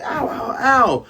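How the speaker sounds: high yelped ows; mock pain cry. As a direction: yelp like stepping on spikes. Pirates hurt, stepping on caltrops.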